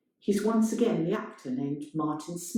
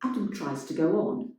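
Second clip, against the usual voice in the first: ordinary delivery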